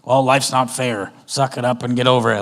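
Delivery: dismissive parent. Retold or imitated. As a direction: play the harsh dismissive parent. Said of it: "the worst thing we can do is go".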